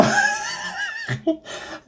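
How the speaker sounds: sudden high-pitched laugh; cracking up, squeaky laugh; high burst of laughter. Real, not acted. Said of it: "laughs at himself".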